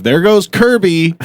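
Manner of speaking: mock-announce it slow and high; teasing call-out. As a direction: sing out the sighting, stretched and high. Retold teasing call from friend.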